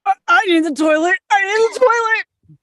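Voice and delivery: loud, rising desperate cry; desperate shout. Acted. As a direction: beg urgently for the toilet. Desperate run to toilet.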